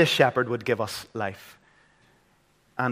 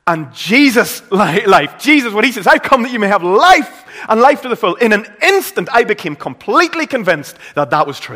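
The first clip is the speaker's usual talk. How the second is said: high, loud, impassioned sermon climax; fervent preaching; 9 semitones higher, 10 dB louder than usual. Acted. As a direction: preach it with full fire. Passionate testimony climax.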